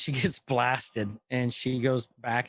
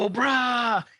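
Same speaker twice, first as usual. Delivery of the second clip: high shouted war cry; loud mock battle cry; Cobra battle cry; 10 semitones higher, 4 dB louder than usual. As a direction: yell it like a cartoon villain army.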